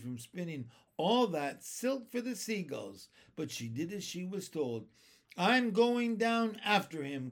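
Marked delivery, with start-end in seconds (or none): 5.3-7.3 higher, louder brave cry. A narrator's reading. James tells the others he will go after the centipede, a high eager cry. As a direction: declare it as you grab the string. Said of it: "cried James".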